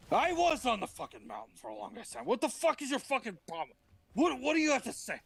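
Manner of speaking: angry Moses. Acted. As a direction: snap back at him, fed up.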